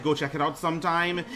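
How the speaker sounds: ordinary talk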